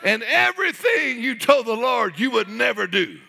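loud, very high shouted sermon; full-force preaching. Real, not acted. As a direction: cry out with mounting fervor.

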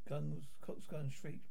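normal talking voice

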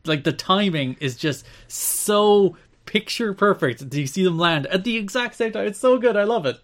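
rising, excited gushing; can't contain the excitement; overjoyed fan raving about the finish. Real, not acted. Gushing over perfect finish.

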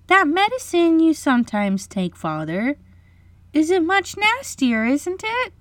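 put-on very high girlish voice; encouraging little girl. A narrator's reading. Wendy encourages Michael before their father, high little-girl voice. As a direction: coax sweetly as a little girl. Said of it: "she said, to encourage Michael".

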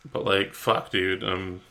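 ordinary talk

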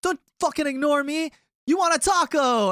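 put-on high, loud drunken insisting; drunk pushy offer. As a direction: demand attention, then push the taco. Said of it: "He's like".

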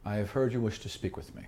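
lightly voiced low, hushed king; a hint of a stoic, imposing ruler; God King. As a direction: speak softly and low as an intense king. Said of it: "the God King ducks under".

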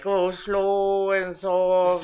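very slow, drawn-out request; slow gentle plea. Retold or imitated. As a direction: stretch it out slowly. Quoting girlfriend.

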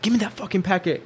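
high mock yell, held back in volume; imitated drill instructor. Officer demanding a packet from recruit, mock yelling.

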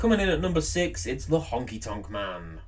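top ten countdown. Acted. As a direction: hype up the next entry like a host. Countdown entry announcement.

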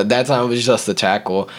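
ordinary talk, nothing marked

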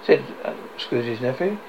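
ordinary delivery, nothing marked

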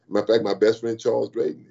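usual speaking voice

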